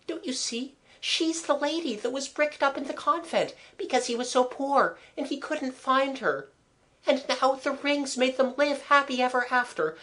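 put-on very high girl's voice, eager; Mabel, romantic girl. A narrator's reading. Girl explaining the lovers' story to friends, eager.

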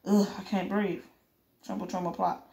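ordinary delivery, nothing marked

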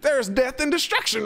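high, disbelieving retort; incredulous. Real, not acted. Player mocking the groundskeeper, high and disbelieving. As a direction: scoff at the caretaker's claim. Mock outrage at the NPC.

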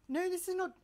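loud correction. Real, not acted.

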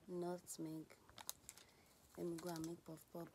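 ordinary talk, nothing marked

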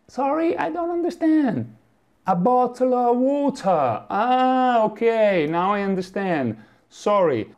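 a lost tourist struggling to understand; a flustered tourist begging a Londoner to repeat; bewildered foreigner. Retold or imitated. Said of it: "non so più l'inglese".

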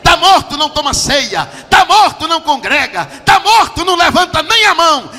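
loud, high, driving sermon climax; full-force Pentecostal preaching; shouting preacher. Real, not acted. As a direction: preach it hard at the top of your voice.

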